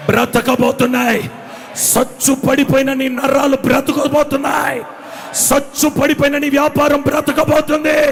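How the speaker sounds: loud, high fervent preaching cry; preaching at full force. Acted. Preacher to his congregation, shouting with full fervour. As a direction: rouse the congregation with rising shouts.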